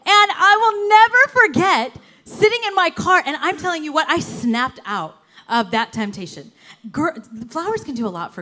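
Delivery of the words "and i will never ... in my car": tearful. Real, not acted. Retelling the roses moment.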